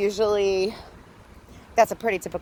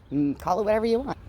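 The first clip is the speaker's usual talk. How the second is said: ordinary delivery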